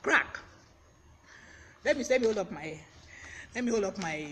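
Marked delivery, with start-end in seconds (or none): 0.0-0.4 "crack" as "krak", higher and louder; pronunciation demo. Shown as a demonstration. Teacher models the word of the day, "crack" said "krak" emphatically. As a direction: pronounce "crack" like K-R-A-K, alone after a pause. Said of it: "and it is pronounced".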